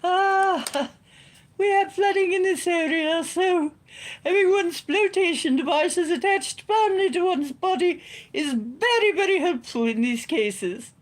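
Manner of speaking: high, loud Hogwarts teacher voice; posh lady voice. Pretend. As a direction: channel a prim, proper schoolmistress. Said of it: "I don't know who I'm channeling right now".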